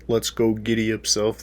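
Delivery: normal talking voice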